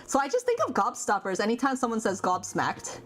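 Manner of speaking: ordinary talk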